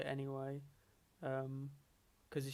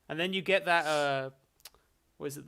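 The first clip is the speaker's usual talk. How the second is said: ordinary talk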